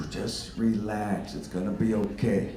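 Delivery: low, soft slow reassurance to himself; quiet self-soothing before the road rage; soothing self-talk. Retold or imitated. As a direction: talk yourself down gently. Calming himself down.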